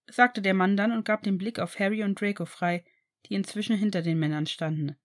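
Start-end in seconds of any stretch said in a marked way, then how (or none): none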